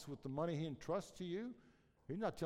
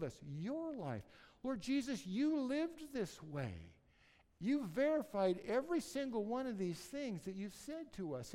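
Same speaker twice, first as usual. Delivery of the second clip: earnest pastoral prayer, lifting higher at the peaks; closing prayer; 4 semitones higher than usual. Acted. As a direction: pray it over the church, sincere and rising.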